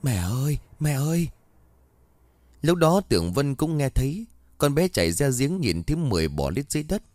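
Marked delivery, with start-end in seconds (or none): 0.0-1.3 put-on mildly frightened child's call; frightened cry. A narrator's reading. Frightened daughter cries out to mother, mild scared cry. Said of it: "sợ hãi hét lên".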